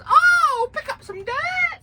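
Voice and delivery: high-pitched mocking machine voice. Farmer mocking the tractor technology: high mocking squeak. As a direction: squeak it like a dopey gadget.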